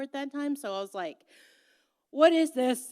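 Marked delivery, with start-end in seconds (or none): 2.1-2.9 loud, high alarmed cry; freaking out. Retold or imitated. Girl finding fleas on her ankle, loud and alarmed. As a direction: panic at what you see. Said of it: "I was freaking out".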